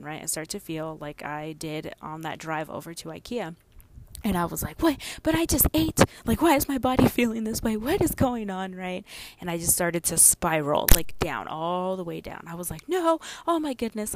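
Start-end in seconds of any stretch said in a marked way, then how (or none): 4.8-8.7 loud, high, baffled protest; retold alarm. Woman recalling her panic at her body after eating, alarmed. 12.9-14.1 high, loud cry of dismay; retold panic. Woman retelling her spiral downward, high and panicked.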